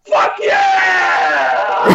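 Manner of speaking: high, drawn-out triumphant yell; long jubilant shout; screamed cheer. Retold or imitated. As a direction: scream it out like your team just scored. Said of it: "and I just scream".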